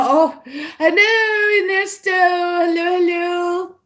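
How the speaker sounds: high, stretched sing-song hello; drawn-out excited greeting. Real, not acted. Greeting a chat viewer.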